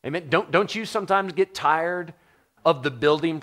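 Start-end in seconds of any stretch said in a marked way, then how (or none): none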